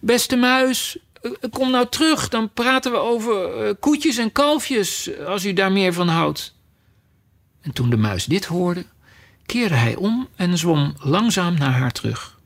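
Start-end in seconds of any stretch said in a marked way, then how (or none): 0.0-6.6 high, gentle coaxing call; softly calling after. Acted. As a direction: coax them back sweetly, with hesitations. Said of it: "riep ze hem zacht achterna".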